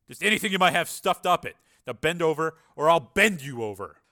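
army sergeant demanding compliance; threatening sergeant. Acted. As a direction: lay down the law. Narrator voices threatening sergeant.

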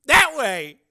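high, loud call; urgent shout. A narrator's reading. Tracy directing the driver, urgent shout. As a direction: shout directions over gunfire. Pointing the way during battle.

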